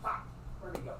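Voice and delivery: retold whisper under the breath. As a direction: hush it as if searching. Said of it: "I was like".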